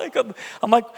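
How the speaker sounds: ordinary talk, nothing marked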